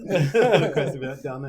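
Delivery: loud joke through laughter; laughing. Real, not acted. Friend teasing patient about being left dirty, laughing. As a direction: tease while laughing out loud.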